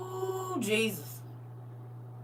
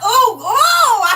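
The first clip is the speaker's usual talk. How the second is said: disgusted squeal; 13 semitones higher, 13 dB louder than usual. Real, not acted. Disgusted by smacking sounds.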